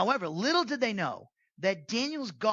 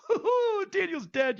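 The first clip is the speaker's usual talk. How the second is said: high, gleeful mock taunt; gloating conspirators; 11 semitones higher, 5 dB louder than usual. Conspirators gloating behind the king's back, high mock taunt. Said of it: "I like to think of them doing this".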